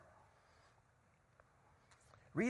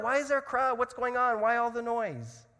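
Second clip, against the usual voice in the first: high, raised questioning call; shouting out; 6 semitones higher, 4 dB louder than usual. Acted. Blind beggar asking the passing crowd what's happening, shouting out. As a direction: call out over a noisy crowd. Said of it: "So he shouts out".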